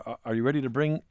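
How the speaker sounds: normal talking voice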